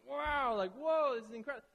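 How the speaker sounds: wow and whoa shouted up high, full of wonder; loud, awestruck shepherds; amazed and excited. Shown as a demonstration. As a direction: gasp it out like you just saw a miracle. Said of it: "their voice inflection was probably different than that".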